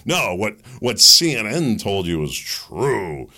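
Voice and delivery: usual speaking voice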